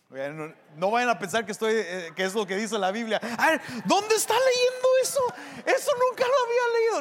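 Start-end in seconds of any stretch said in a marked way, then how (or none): 3.4-7.0 high-pitched mock protest; fast, high, fussy challenge; indignant objector. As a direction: imitate a scandalized listener, pitched way up. Imitating an objecting listener.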